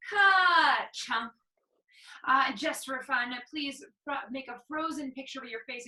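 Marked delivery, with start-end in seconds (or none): 0.1-1.3 loud ka-chunk stop; louder clunky sound effect. Shown as a demonstration. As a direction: slam the coaster to a halt with ka-chunk. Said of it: "come to the end of the roller coaster with a".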